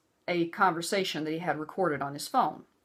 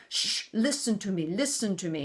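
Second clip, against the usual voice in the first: mock patronizing hush; lightly voiced condescending husband; 2 semitones higher than usual. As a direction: imitate his talking down to her. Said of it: "He was being very condescending".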